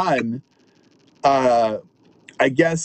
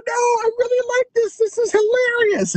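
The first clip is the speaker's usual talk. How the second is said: much higher, put-on woman's voice; high delighted woman. Imitating a woman who loves the dirty show, high and delighted. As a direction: chirp it up high like a tickled lady.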